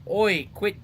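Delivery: lightly put-on British-type accent; improvised accent. Player repeating a line to identify his own improvised accent. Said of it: "for me, what I said".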